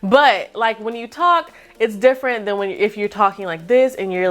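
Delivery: ordinary talk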